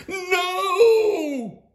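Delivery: high, loud group cry of protest; children shouting. A narrator's reading. Children protesting their pets being taken, loud wail. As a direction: wail it like a crowd of kids. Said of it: "All the girls and boys shouted".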